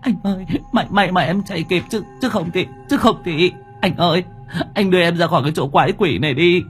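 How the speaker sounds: high tearful woman's voice, stammering; whimpering. A narrator's reading. Girlfriend clings to her rescuer boyfriend, whimpering. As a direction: whimper it high, stumbling over repeated words. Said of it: "ôm chầm lấy Kiệt thút thít".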